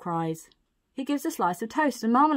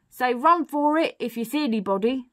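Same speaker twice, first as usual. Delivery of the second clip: raised, urging burglar voice; storybook burglar prompting, pitched up; coaching a baby; 5 semitones higher, 4 dB louder than usual. Acted. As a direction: instruct a baby lookout.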